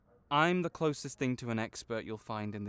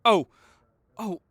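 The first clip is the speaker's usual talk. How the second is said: startled gasp; 7 semitones higher, 16 dB louder than usual. Acted. Owner suddenly upset or hurt.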